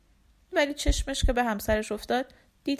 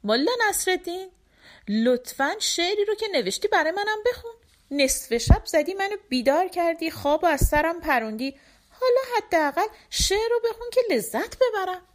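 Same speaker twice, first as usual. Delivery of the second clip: higher, quick pleading wife; curious and coaxing; 8 semitones higher than usual. A narrator's reading. Wife curiously coaxes Mulla to read his poem, higher and quick. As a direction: needle him to read it. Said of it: "با کنجکاوی اومد جلو و گفت".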